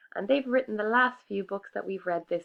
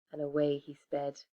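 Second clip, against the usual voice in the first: ordinary delivery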